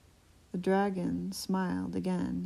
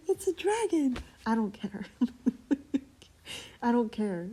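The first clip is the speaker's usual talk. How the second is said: giggling, higher and louder; laughing; 6 semitones higher, 4 dB louder than usual. Real, not acted. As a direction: laugh through it. Delighted laughing aside.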